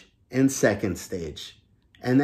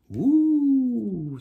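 a much higher cartoon whoop; Rocket cheering; 13 semitones higher than usual. Rocket character cheers about launching, high excited whoop.

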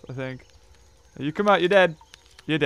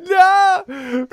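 laughing cry; 12 semitones higher, 6 dB louder than usual. Real, not acted. Dying in game.

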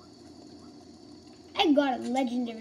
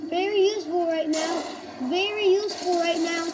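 louder, higher mock praise; sarcastic; 5 semitones higher, 5 dB louder than usual. Real, not acted. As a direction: lay the sarcasm on thick.

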